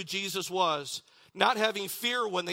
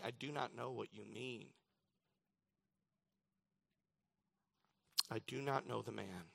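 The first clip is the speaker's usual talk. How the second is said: much softer, low denial; quiet denial; 6 semitones lower, 11 dB quieter than usual. Acted. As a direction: drop low and hush it like a guilty denial. Peter denying with an oath.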